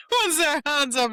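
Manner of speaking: high, loud incredulous question; surprised exclamation. Retold or imitated. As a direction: act out the room's astonishment. Everyone surprised by the room.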